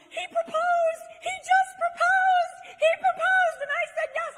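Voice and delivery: full-throated, very high shout; screaming with excitement. Retold or imitated. Newly engaged woman telling everybody on the street, ecstatic scream. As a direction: yell it out to the whole street, stretching the words. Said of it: "screaming at the top of my lungs".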